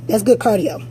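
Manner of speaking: normal talking voice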